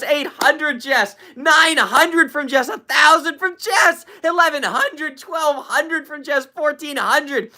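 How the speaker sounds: high, slowing count as the total climbs; rising excitement. Real, not acted. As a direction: count up the gift in growing amazement.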